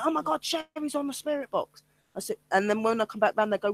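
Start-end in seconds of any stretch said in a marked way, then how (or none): 0.0-1.8 high, soft mock astonishment; imitated amazement. Medium retells colleagues hearing her on the spirit box, amazed. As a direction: mimic stunned colleagues in a small high voice.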